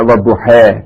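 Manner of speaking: وضحے with the last syllable said "hay"; final "ay" vowel. Shown as a demonstration. Repeating the variant reading for students, وضحے ending in "ay". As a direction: end وضحے on a held "ay", not "aa". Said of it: "یہ pronunciation کا فرق ہے".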